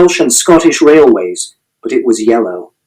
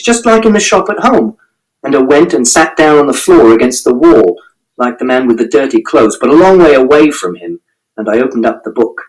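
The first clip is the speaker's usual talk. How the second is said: usual speaking voice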